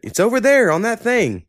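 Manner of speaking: high-pitched mock dad giving vague directions; dad impression. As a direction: imitate dad, voice pushed way up. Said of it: "He'll, he'll ask me".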